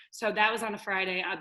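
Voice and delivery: normal talking voice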